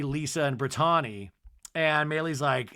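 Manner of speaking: ordinary delivery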